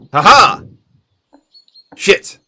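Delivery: loud, high mock-triumphant laugh, then a cursing letdown; triumphant, then dismayed attacker. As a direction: crow in victory, then realize it failed. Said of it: "and it's just like".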